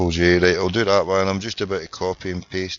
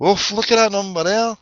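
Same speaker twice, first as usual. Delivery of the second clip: high, loud reaction; surprised exclamation; 10 semitones higher, 7 dB louder than usual. Real, not acted. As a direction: burst out, pitch and volume jumping up. Reacting to a number seen.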